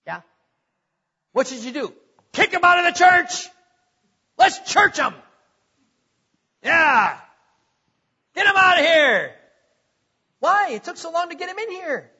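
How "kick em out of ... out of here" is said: hammed-up shouts of a riled congregation, louder; mock angry mob. Preacher mocking church members who want troublemakers gone, mock angry shouting. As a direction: play the outraged crowd for laughs.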